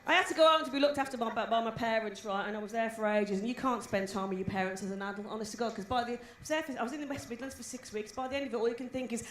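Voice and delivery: ordinary talk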